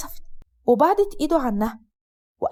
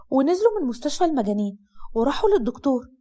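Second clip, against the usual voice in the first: ordinary delivery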